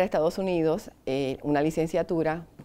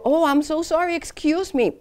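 flustered apology in English; 11 semitones higher, 5 dB louder than usual. Retold or imitated. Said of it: "Y yo vengo y le digo".

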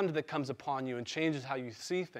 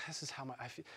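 much softer, halting admission; quiet confession; 4 semitones lower, 15 dB quieter, much faster than usual. Retold or imitated. Admitting his feelings to his wife on the couch, softly. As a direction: murmur it as an honest confession. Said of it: "I'm like".

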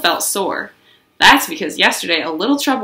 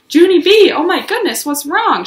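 higher, loud calling out; worried calling; 9 semitones higher, 5 dB louder than usual. Acted. Mother calling from the back door, worried. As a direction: call out in alarm. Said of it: "she called".

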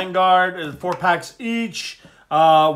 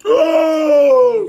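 loud high startled gasp; surprised shout; 10 semitones higher, 10 dB louder than usual. Real, not acted. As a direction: yelp at the pull. Big pull reveal.

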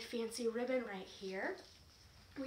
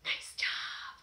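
whispered praise. Real, not acted. Praise after warm-up.

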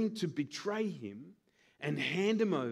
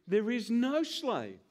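ordinary talk, nothing marked

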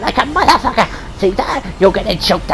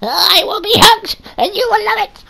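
whiny demand; 11 semitones higher than usual. Acted.

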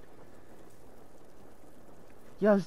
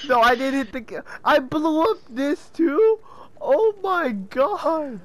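laughing disbelief; 7 semitones higher, 3 dB louder than usual. Real, not acted. Laughing disbelief after blast.

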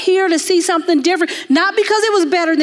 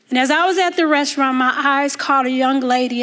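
usual speaking voice

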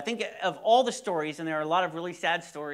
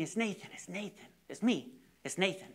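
reassuring; 2 semitones higher, 6 dB quieter than usual. Retold or imitated. Calming a frightened patient.

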